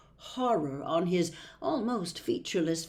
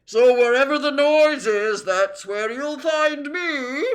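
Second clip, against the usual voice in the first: appreciative din; 7 semitones higher, 8 dB louder than usual. A narrator's reading. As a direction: sing out the ending with relish. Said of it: "with an appreciative smile".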